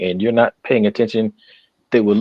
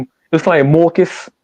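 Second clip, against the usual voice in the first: a light New York twang on Marcus, vowel opened to mawr; New York accent; 6 semitones higher than usual. Retold or imitated. Recalling how his first wife said his name, lightly New York. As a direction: say Marcus like Mookie from Brooklyn. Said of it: "Spike Lee is, uh, Mookie?".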